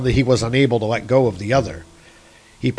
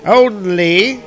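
high, louder eagle voice laying down terms; eagle's condition; 8 semitones higher, 4 dB louder than usual. A narrator's reading. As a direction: hold out the condition like a bird of prey. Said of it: "said the eagle".

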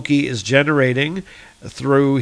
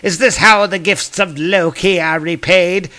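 angry Loki; 4 semitones higher than usual. Acted. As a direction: scold the ungrateful giants with mild mock fury. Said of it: "And he gets angry".

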